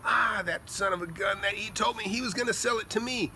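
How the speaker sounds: high, aggrieved neighbour voice; mock outrage, pitched well up; imitated indignation. Neighbour who was promised the lot, indignant. Neighbours angry at losing the deal.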